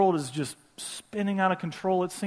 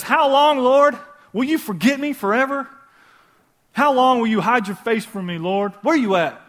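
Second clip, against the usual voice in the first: anguished plea; 6 semitones higher, 7 dB louder than usual. Acted. Despairing psalm voiced.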